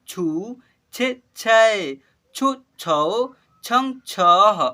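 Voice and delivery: slow, loud, drawn-out syllable recitation; syllable drill. Shown as a demonstration. Teacher leads class in reciting barahkhadi, slow and loud. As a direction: call out each syllable clearly for the class to repeat. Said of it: "आइए मेरे साथ साथ आप लोग उच्चारण करना सीखिए".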